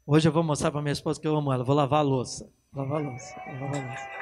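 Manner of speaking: mock a husband forcing himself to remember; anxious husband. Mocking husband forcing love.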